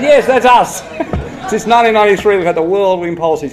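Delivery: high, loud, laughing self-mockery; laughing confession. Real, not acted. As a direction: own up to the failure through laughter. Mock confession of national failure.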